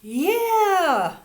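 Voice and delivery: high, loud cheering yell. A narrator's reading. Big boys yelling from a window, loud cheer. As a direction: jeer from a window. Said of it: "yelled some big boys".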